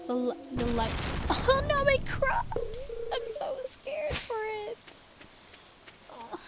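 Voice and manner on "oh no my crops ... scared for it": hammed-up, much higher wail, slow start; mock despair. Player mourning her crops in a hammed-up high wail. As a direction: whine like a cartoon farmer losing everything.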